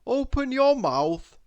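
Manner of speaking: a cheery bossy doctor; doctor's instruction. A narrator's reading. Doctor Makewell examines Miss Giggles, cheerful doctor voice. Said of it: "said Doctor Makewell".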